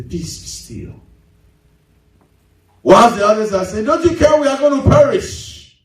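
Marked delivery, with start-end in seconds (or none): 0.0-0.9 near-whispered, unhurried authority; very soft, breath-only calming command; calm hushed command. Acted. Jesus calmly stilling the storm. 3.8-5.8 mock panic. Panicked disciples wake Jesus in storm.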